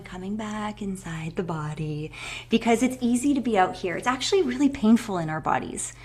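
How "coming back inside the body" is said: slow, hushed meditation cue; soft guiding voice. Retold or imitated. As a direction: gently call them back. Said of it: "hear my little voice going".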